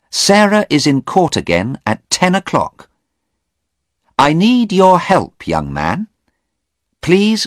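firm woman at the door; urgent Anna. Acted. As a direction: get him to open up now. Anna voiced in story.

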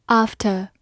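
"after" in a British accent, long back AH, no R; British pronunciation. Shown as a demonstration. Teacher repeats a review word for learners, British accent. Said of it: "美式发音读作after".

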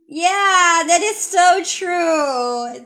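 teacher demos wholehearted agreement; emphatic agreement. Shown as a demonstration. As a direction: stretch "so" in strong agreement. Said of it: "你说的太对了".

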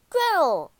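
high, loud, falling call; boy calling out. A narrator's reading. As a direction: call joyfully to your sister. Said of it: "called Hansel".

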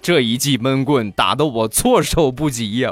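ordinary delivery

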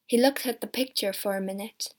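ordinary delivery, nothing marked

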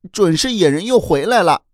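high-pitched alarmed exclamation; startled cry. A narrator's reading. Children fearing the wild men are back, startled high cry. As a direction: blurt it out high, in fright. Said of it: "惊叫道".

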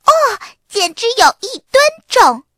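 提高嗓门，又高又响地喊出来; straining and exclaiming under a heavy load; 用力搬重物. A narrator's reading. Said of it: "用力地搬起箱子说". (使劲搬东西时吃力地惊叹)

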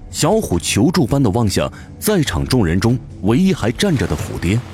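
normal talking voice

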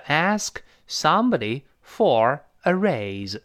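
"ask somebody for a raise" slowed, each word spaced out; fixed phrase model. Shown as a demonstration. Teacher drills the set phrase for students to memorise, slowly. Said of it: "固定搭配".

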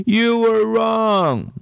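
high, slow, teary whine; little girl sobbing, drawn-out and high. Acted. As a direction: wail it through tears like a hurt child. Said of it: "she sobbed".

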